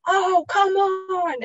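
slow, high, drawn-out exasperated groan; mock irritated parent over a spill. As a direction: let the sigh of annoyance drag it out. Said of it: "huge, like".

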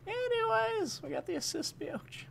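way up high, squeaky and silly; a goofy high squeak to brush off the moment; squeaky joke voice. Pretend. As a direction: pop into a tiny cartoon squeak.

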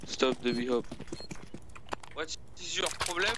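usual speaking voice

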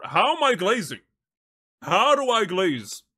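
can't believe the accusation; indignant disbelief. Real, not acted. Streamer pushing back at chat, loud incredulous protest.

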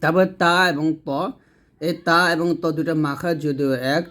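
ordinary talk, nothing marked

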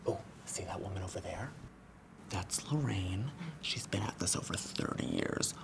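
hushed office gossip; whispered gossip. Real, not acted. Trainer whispering gossip about a coworker across the room. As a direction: whisper so she can't hear you.

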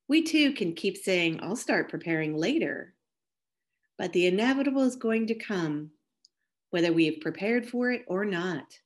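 ordinary delivery

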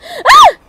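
sudden high shriek; scream of fright. Real, not acted. Startled by a demon, screams.

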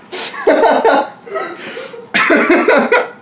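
high, helpless laughter; real laughing fit. Real, not acted. Reader breaking up over the story, laughing hard. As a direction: crack up and keep laughing.

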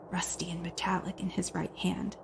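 hushed, breathy suspense whisper; whispered narration. A narrator's reading. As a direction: whisper it like a secret. Suspense narration, ominous object.

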